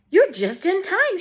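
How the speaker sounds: shouted. A narrator's reading. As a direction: call it out with joy. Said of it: "shouted Grandpa Joe".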